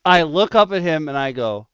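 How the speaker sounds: ordinary talk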